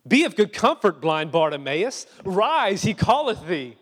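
sudden bright encouragement from the crowd; eager crowd call. Retold or imitated. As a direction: crowd cheering the blind man on. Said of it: "all of a sudden changed their tone".

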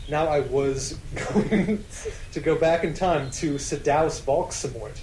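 normal talking voice

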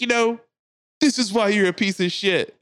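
high, scolding mock yell; imitated yelling. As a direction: berate her from upstairs as the fed-up man. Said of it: "Yells at her from the top steps".

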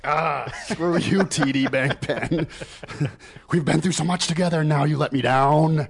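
hammed-up pissed off rant; mock angry rant. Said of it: "I get pissed, like".

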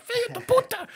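high put-on Portuguese curse; a curse thrown in a foreign voice; mock cursing passenger. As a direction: spit it out like an angry passenger. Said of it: "she was obviously cursing at me".

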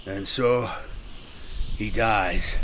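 strained answer broken by a long pause; halting. A narrator's reading. Dying sergeant answers the rite, halting. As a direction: stop and start, labouring for each phrase. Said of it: "answered in a halting voice".

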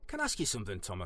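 normal talking voice

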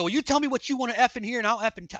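high, heated mock outburst; acting out an angry comeback; losing it, retold. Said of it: "like, I lost it on her".

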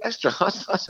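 high-pitched amused disbelief, retold; incredulous retort. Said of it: "It sounds so crazy".

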